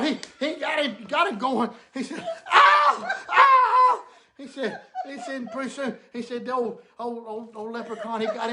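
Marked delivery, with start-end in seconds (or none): 2.5-4.0 very high, loud hammed-up oh of pain; mock pained cries. Drunk man cries out as the leprechaun gets him. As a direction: wail it out, laughing through it.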